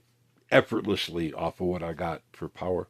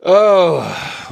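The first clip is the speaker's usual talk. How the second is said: surprised oh; 10 semitones higher, 11 dB louder than usual. Real, not acted. Sudden exclamation mid chat.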